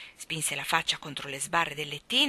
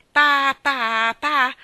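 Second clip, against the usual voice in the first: put-on loud, high baby syllables; baby babble; 8 semitones higher, 13 dB louder, much slower than usual. A narrator's reading. John answering the starling, loud babbling. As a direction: blurt out stretched baby sounds.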